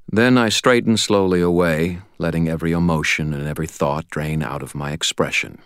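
ordinary delivery, nothing marked

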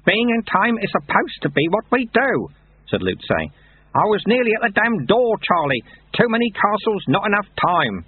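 high-pitched, protesting character voice; upset Lutze. Acted. Lutze reliving his failure at the door, upset.

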